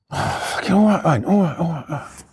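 imitated exasperated giving-in; acted fed-up agreement; grudging surrender. Retold man grudgingly gives in.

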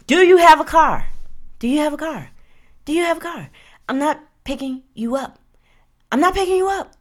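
high-pitched, emphatic interrogation of a suitor; insistent grilling. Acted. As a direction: fire off the question and insist. Acts out screening questions, insistent.

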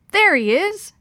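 high, loud cry of discovery; triumphant spotting. A narrator's reading. Guide spots the snake on the bank, excited. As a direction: call out the find.

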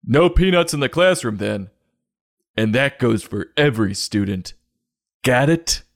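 mock stern teacher. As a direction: lay down the law to the class. Teacher scolding parents.

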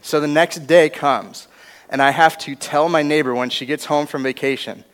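ordinary talk, nothing marked

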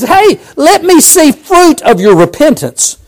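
slow, high, earnest voice of Jesus; Jesus speaking. Acted. Jesus challenging the religious people, slow and earnest. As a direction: lay the challenge down slowly.

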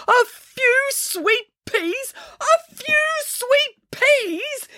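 very high, drawn-out incredulous cry; slow high-pitched yell of disbelief; outraged yelling. A narrator's reading. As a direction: repeat it in slow, strained outrage. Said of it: "yelled Mister Pilcher".

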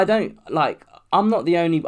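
usual speaking voice

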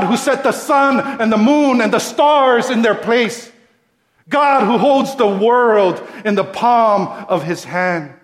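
loud, high, building praise of God; preaching at full force. Acted. Preacher extolling God's greatness to his congregation. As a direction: swell with awe at each God, who.

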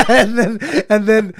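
bursts of high laughter through the words; laughing. Real, not acted. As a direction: laugh out the words at your own joke. Laughing at own joke.

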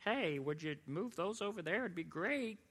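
a hint of a timid manager; mock polite boss. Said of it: "you're not just like".

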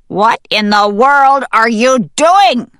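high, loud incredulous call; exasperated call. Acted. Voice outside the grave to panicking hen, exasperated call.

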